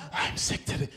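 weak breathy whisper; whispered sick voice. Shown as a demonstration. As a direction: whisper it like you're dying. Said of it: "rehearse your whole sick voice".

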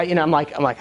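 ordinary delivery

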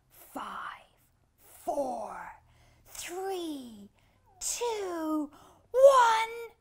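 countdown, whisper to shout; building countdown. Acted. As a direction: whisper rising to a loud high shout. Countdown before takeoff.

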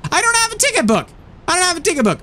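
high-pitched exasperated squawk; exasperated exclamation. Real, not acted. Player finding no ticket book, exasperated exclamation. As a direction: pitch shoots up in protest.

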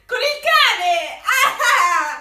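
loud, slow victory cry; triumphant shout. Real, not acted. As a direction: shout it like you won. Finally guesses the phrase. (urlo di vittoria)

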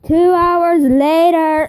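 slow booming announcer. Pretend. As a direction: announce it like a title card. Mock title card announcement.

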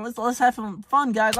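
high, light mocking tone; mock girly casual voice. Mocking casual hoopers, high light mock voice. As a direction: make them sound harmless.

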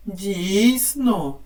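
normal talking voice

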